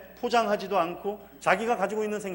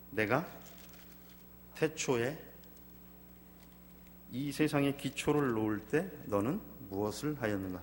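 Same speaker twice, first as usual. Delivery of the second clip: voice of God; 6 semitones lower, 5 dB quieter than usual. Acted. Preacher voicing God questioning Job, low weighty voice. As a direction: speak as God from the whirlwind.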